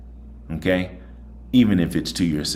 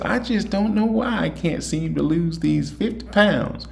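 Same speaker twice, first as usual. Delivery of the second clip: much higher, plaintive imitation of a guest; guest's complaint; 12 semitones higher than usual. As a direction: mimic the frustrated guest, pitched way up. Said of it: "Like one of my guests said".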